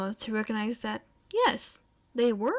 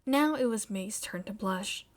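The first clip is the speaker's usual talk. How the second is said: ordinary delivery, nothing marked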